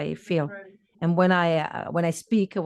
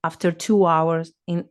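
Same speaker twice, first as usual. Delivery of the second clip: ordinary talk, nothing marked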